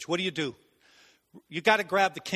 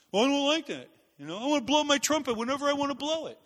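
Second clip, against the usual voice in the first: higher-pitched mock complainer; petulant refusal; 5 semitones higher than usual. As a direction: whine like a stubborn follower. Voices a defiant unwilling follower.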